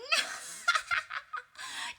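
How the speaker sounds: high mocking cackle; shrill high poltergeist laugh; gleeful cackle. A narrator's reading. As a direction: laugh in Filch's face. Peeves cackles at Filch.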